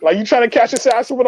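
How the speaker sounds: acted-out angry taunt; retold confrontation. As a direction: square up to him in a mock yell. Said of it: "I get in his face and I start yelling".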